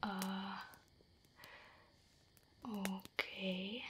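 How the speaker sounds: ordinary delivery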